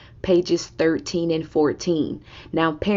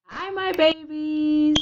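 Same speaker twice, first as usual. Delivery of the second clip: fond greeting; 11 semitones higher, slower than usual. Real, not acted. Teacher greets class.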